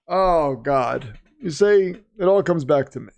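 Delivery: ordinary delivery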